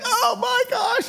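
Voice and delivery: very high, drawn-out fake wail; faked pain. Kid faking pain after a harmless spanking, hammed-up. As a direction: wail as if it hurt, obviously pretending. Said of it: "But you just fake it".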